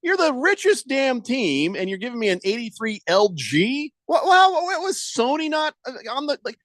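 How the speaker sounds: put-on indignant old broadcaster. Mock outraged rant as Sterling.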